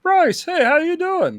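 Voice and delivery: high little voice, friendly hello; George Lucas greeting. Retold or imitated. George Lucas greeting Bryce, high friendly voice. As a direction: greet her warmly in a high puppet voice. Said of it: "he's like".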